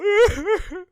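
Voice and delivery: sudden high burst of laughter; real high-pitched laugh. Real, not acted. Laughs at own story.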